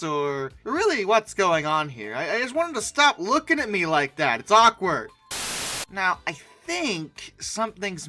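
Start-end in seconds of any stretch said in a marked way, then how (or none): none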